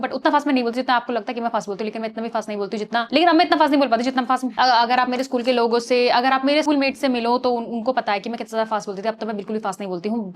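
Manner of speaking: rapid-fire demo of fast speech; fast talking. Shown as a demonstration. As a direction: rattle it off quickly, words tumbling together. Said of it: "मैंने ये fast बोलने के लिए दिखाया था".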